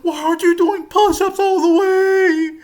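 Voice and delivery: high, loud nasal-free mock whine of a tattletale; mocking whiny snitch. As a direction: squeal it like the class snitch. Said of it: "he would be like".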